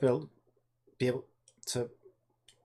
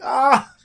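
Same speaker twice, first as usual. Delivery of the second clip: frustrated cry; 9 semitones higher, 12 dB louder than usual. Retold or imitated. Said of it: "you'd be like".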